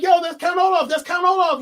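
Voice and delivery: high, loud insistent shout; imitated kids shouting the villain's name; mock kids yelling. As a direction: hammer the name like frantic kids. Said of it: "they're like".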